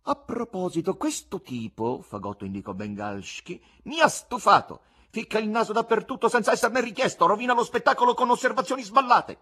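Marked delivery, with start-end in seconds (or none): 0.0-2.0 lightly voiced goatish showman; raised pitch, casually pointing someone out. A narrator's reading. 3.8-9.4 high, loud fed-up showman. A narrator's reading. Showman to audience about the meddling presenter, loud exasperation.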